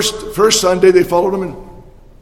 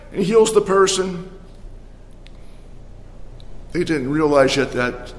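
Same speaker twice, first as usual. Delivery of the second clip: normal talking voice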